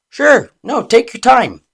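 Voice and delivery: loud, high fake agreeableness; exaggeratedly obliging, tense underneath; sarcastic. Shown as a demonstration. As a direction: pretend patience with frustration leaking out. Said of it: "but the tone carries tension".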